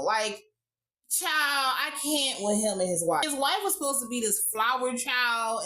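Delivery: normal talking voice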